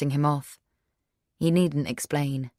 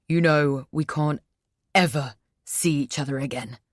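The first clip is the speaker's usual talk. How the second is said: threatening. Acted. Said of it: "he threatens".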